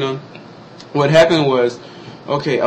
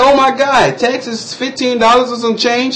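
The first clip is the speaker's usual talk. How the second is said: high-pitched mock disbelief; shocked reaction; 8 semitones higher than usual. Customer balking at the cashier's total, shocked. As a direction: retell it as high, drawn-out shock at a bill.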